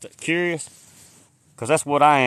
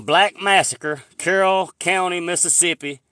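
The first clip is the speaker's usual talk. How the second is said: slow, louder, word by word; dictating a search term to viewers, slow; slow dictation; 4 semitones higher, 4 dB louder than usual. Real, not acted. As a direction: dictate it so they can write it down. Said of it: "write down".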